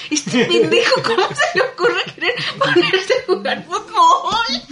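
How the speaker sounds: slow, high, laughing outrage; laughing exasperation. Retold or imitated. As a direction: laugh through your annoyance at him. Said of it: "yo estaba bien emputada".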